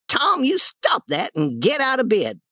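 high-pitched scolding voice; scolding aunt. Acted. Aunt seeing through Tom's act, high-pitched scolding. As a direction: order him up in a high voice.

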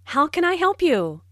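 ordinary delivery

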